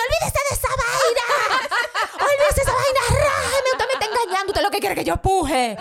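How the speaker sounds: hammed-up panicked refusal; mock yelling. Woman in labor yelling at her doctor.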